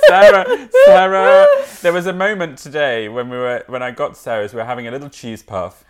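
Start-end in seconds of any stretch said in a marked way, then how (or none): 0.0-1.5 high, loud laughing name-call. Real, not acted. Friend cracking up over soap dispenser, high laughing exclamation. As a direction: squeal "Sarah" through laughter.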